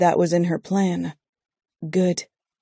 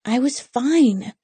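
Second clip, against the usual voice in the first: higher, drawn-out pouty protest; pouting; 7 semitones higher, slower than usual. A narrator's reading. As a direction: whine it slowly with a sulky pout. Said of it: "Bree pouts slightly".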